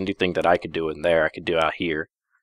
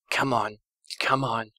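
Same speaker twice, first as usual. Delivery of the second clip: strangled urging; 5 semitones higher, 5 dB quieter than usual. Acted. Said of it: "strangling noise came from her throat".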